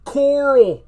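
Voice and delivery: high, very loud drawn-out call; Rick from Walking Dead. Acted. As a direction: shout for your son in an exaggerated drawl. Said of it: "Rick from Walking Dead".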